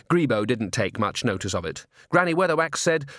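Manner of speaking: ordinary delivery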